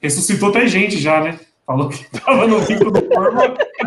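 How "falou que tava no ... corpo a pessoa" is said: laughing through the words; breaking into laughter mid-sentence. Real, not acted. Laughing while telling funny story.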